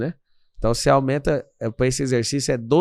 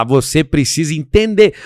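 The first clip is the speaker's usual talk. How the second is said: louder, firmer demo line; calling him to account; 2 semitones higher, 7 dB louder than usual. Shown as a demonstration. Speaker demonstrating how he calls a man to responsibility, firm and louder. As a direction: lean on it to hold someone responsible. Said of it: "eu começo a falar".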